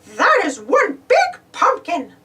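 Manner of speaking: slow, amazed Mickey. Acted. Mickey admiring the big pumpkin, slow and impressed. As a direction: marvel slowly in Mickey's voice. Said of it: "said Mickey".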